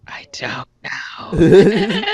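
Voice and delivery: slow breathy laugh through words; laughing whisper. Real, not acted. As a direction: whisper it while cracking up.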